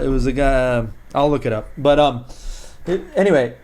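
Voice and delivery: ordinary talk, nothing marked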